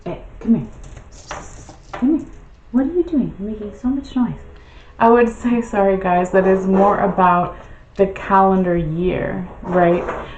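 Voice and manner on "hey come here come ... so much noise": pet talk. Real, not acted. Calling cat, baby pet talk.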